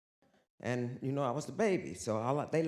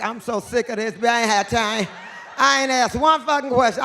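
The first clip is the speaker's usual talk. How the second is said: loud, higher impression of a friend complaining; Michelle; 4 semitones higher, 8 dB louder than usual. Imitating Michelle griping about being asked nothing. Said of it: "Michelle like".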